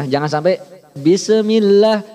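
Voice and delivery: "bismillah" with an echoing E after the s, slow; wrong bouncing sin. Shown as a demonstration. Teacher showing students the wrong bouncing sin. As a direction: demo the wrong "bisemillah" with a bounce on s. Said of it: "karena sin bukan huruf qalqalah".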